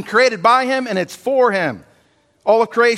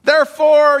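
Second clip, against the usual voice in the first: emphatic; 7 semitones higher, 5 dB louder than usual. Shown as a demonstration. Repeated for suspense.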